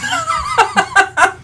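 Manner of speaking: high burst of laughter. Real, not acted. Co-host laughs at the tiny demo, high burst of laughter.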